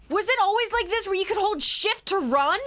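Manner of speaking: high, amazed and loud; excited. Real, not acted. Discovers sprinting in game.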